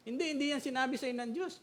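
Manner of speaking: high, quick skeptical retort; playful imitation of a doubter; mock objection. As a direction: snap back a doubtful correction.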